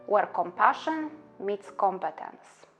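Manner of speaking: normal talking voice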